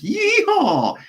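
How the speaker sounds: high whooping cowboy holler; mock hillbilly whoop; hillbilly yeehaw.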